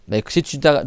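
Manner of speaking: ordinary talk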